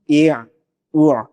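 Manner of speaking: drawn-out ain sound from mid-throat; throat sound demo. Shown as a demonstration. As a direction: hold the ع sound slowly in the throat. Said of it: "گلے کے درمیان سے بھی".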